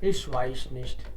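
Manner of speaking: very slow, low and soft; slow noncommittal mumble. Shown as a demonstration. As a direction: drag it out, uninterested. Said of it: "कभी-कभी वो बोलते हैं".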